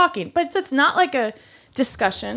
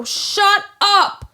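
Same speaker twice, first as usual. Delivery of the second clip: much higher, louder shut up; 12 semitones higher, 5 dB louder than usual. Retold or imitated. A server repeating shut up to a coworker, louder. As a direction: slam it back at him. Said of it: "said it loud".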